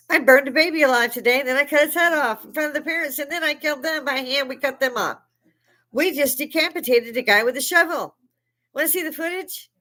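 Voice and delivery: high, quick, excited bragging; mock gleeful boasting. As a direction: imitate a killer gleefully showing off. Mimics attackers boasting of killings.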